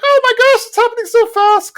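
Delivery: very high, thrilled mock girlish voice; hammed-up happy squeak, far above your own pitch; happy squeal. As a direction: squeal it excitedly, imitating an overjoyed bride. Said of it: "Luann's all happy".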